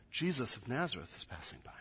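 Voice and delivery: very soft, quick near-whisper; hushed announcement. Acted. They tell the blind man who passes, hushed. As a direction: pass the news along low and fast.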